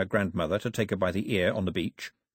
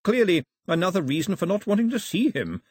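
smoothly diplomatic, pitched higher; smooth reply; 8 semitones higher, 3 dB louder than usual. A narrator's reading. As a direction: slip the retort in smoothly.

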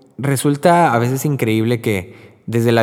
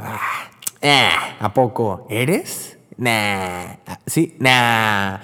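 joking doubt; much slower than usual. Retold or imitated. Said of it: "como de broma".

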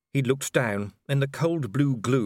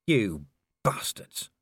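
slow, low angry growl; 3 semitones lower, much slower than usual. A narrator's reading. Vimes cornered in a tree, slow bitter growl. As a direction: drag the insult out through the teeth. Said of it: "Vimes growled".

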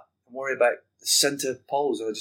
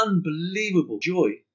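usual speaking voice

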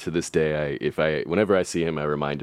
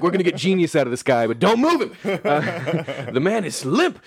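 voix de ricanement moqueur; mock handlers, higher and laughing; 8 semitones higher than usual. Mock scientists handling limp genius.

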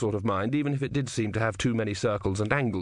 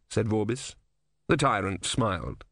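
ordinary delivery, nothing marked

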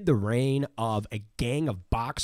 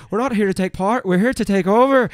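much higher Irish brogue boast; Conor McGregor; 9 semitones higher, 7 dB louder than usual. Retold or imitated. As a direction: brag it like a cocky Irish fighter. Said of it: "when you have Conor McGregor".

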